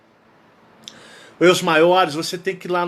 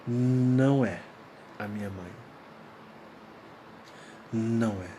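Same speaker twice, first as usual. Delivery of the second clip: much softer, hushed inner thought; 7 semitones lower, 9 dB quieter than usual. Retold or imitated. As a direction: say it to yourself, barely aloud. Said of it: "e eu disse comigo".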